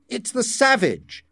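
high-pitched alarmed realisation; dismayed. A narrator's reading. Deputy director recognising the savage, dismayed.

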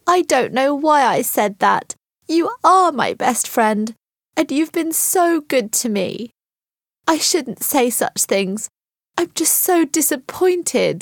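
high, pleading, distressed voice; upset apology. A narrator's reading. Kate tells her best friend she is sorry, upset and apologetic.

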